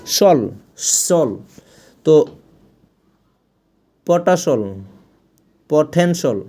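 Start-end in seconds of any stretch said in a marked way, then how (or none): 0.1-1.4 সল, one drawn-out syllable, shol; reduced ending. Shown as a demonstration. Teacher modelling the ending for learners, slow separate সল. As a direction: say সল slowly with no i-a glide. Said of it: "আপনার এটার উচ্চারণ হবে".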